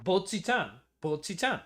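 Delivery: "Bocchi-chan" with pure o and held stop; Japanese pronunciation. Retold or imitated. Echoing the played Japanese clip.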